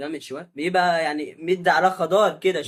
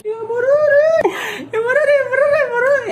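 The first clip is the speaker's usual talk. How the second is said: mock wailing; 5 dB louder than usual. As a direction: wail it like a hammed-up mourner. (عويل تمثيلي ساخر)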